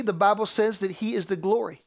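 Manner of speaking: ordinary talk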